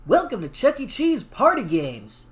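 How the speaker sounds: cheery slow mascot greeting. Acted. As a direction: welcome kids like a mascot. Voicing Chuck E. mascot.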